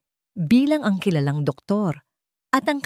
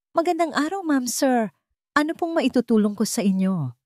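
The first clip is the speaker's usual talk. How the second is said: masiglang tindero; 7 semitones higher than usual. A narrator's reading.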